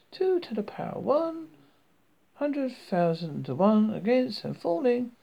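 high, lightly voiced woman announcer; high-pitched, sensible announcement. A narrator's reading. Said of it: "sounded quite a sensible voice".

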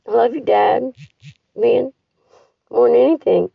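real sobbing cries, high and loud; loud tearful goodbye to her dad; crying out. Real, not acted. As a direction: weep it out, voice breaking. Crying farewell to dying dad.

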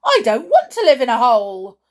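loud indignant shout. A narrator's reading. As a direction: bellow the refusal. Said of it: "Bruno shouted".

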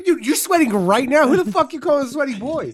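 loud, high, hammed-up indignant shout; mock outrage. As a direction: bark it back like you're deeply offended, but joking. Mock offense at being called sweaty.

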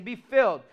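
firm, punched command; a firm, loud "Be filled."; commanding quote. Acted. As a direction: say it like an order from Paul. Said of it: "He's saying".

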